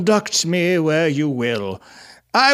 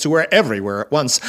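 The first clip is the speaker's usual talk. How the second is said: usual speaking voice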